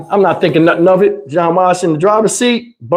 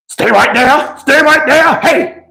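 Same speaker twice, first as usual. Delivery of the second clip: raised, pitched-up shout of stay right there; mock yell at the goat; 7 semitones higher, slower than usual. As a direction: bark the order at a goat you can't catch. Stepdad yelling at the goat.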